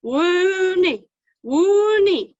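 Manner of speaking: long, loud donkey bray; high imitated donkey call, falling at the end; old donkey bray. A narrator's reading. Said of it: "an old donkey standing with his head".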